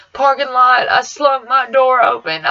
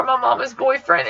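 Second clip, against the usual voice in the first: ordinary talk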